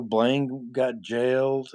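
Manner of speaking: ordinary talk, nothing marked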